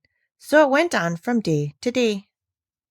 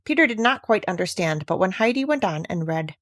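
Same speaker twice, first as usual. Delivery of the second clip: usual speaking voice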